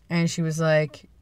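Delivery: usual speaking voice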